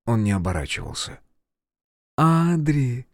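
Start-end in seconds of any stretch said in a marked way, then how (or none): 2.2-3.1 tender mother's call. Acted. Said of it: "Голос мамы. Добрый, родной голос".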